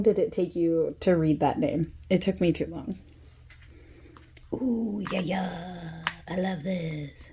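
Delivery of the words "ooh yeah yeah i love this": demon voice. Real, not acted. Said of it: "do I sound like a demon?".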